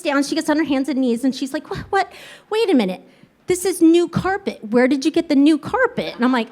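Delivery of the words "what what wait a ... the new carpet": higher-pitched mock bewilderment; incredulous retold questioning; baffled mother. Baffled mother voiced in story.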